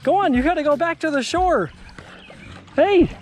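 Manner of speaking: high, fond shooing of a pet dog; talking to a dog. Real, not acted.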